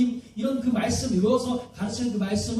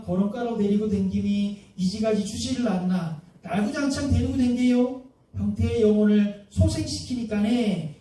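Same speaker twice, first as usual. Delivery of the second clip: Psalm retold in broad Gangwon dialect, homely and folksy. Shown as a demonstration. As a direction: talk it like a Gangwon country neighbour. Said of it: "강원도 사투리로 제가 한번 읽어본 거예요".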